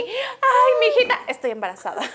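high, loud mocking girly whine; mock confession. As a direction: ham up a girl confessing. Imitating a pregnant girl's confession. (imitando a una chica, en tono burlón)

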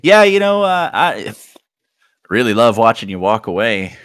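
high and loud at first, then settling. Retold or imitated. Older woman admits watching him, high and loud. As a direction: relish every word of the tease. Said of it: "She's like".

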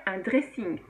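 "dressing" said slowly with a French accent; the English loanword in a plain French accent. Shown as a demonstration. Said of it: "avec l'accent français".

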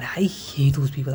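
hushed whisper. Real, not acted. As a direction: whisper the disgust. Venting disgust at people.

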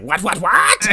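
high, loud cartoon-mother squawk; Kyle's mom from South Park. Podcaster mocking a character as a cartoon mother, high and loud. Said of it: "he's now Kyle's mom from South Park".

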